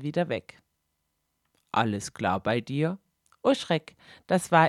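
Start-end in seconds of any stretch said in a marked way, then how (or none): 1.7-3.0 Regisseur. Acted. Regisseur ruft durch die Tür.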